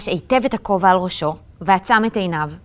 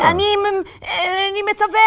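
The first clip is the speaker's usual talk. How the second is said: halting, unsure, a little higher; hesitant; 8 semitones higher than usual. A narrator's reading. Itamar hesitantly trying the magic command. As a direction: stumble over the command timidly. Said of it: "היסס קולו של איתמר".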